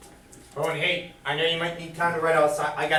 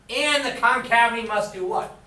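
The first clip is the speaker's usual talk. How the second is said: ordinary delivery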